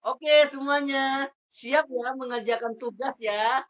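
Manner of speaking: loud, high, stretched-out class rallying call; lively intonation. Shown as a demonstration. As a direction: fire up the students, slow and loud. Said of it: "Tapi pakai intonasi". (seruan lantang penuh semangat)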